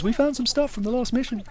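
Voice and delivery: high-pitched lady scientist; lightly voiced female researcher. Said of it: "a research lady who goes".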